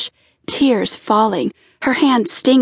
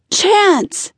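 calling out; 10 semitones higher than usual. A narrator's reading. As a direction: call his name over the waterfall. Calling to him at waterfall.

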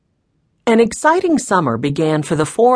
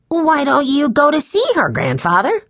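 put-on high-pitched child's suggestion; little boy innocently asking; eager young boy; 10 semitones higher than usual. A narrator's reading. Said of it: "Benny asked".

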